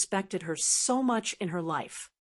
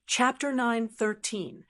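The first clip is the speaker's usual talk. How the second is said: ordinary delivery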